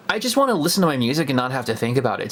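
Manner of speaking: ordinary talk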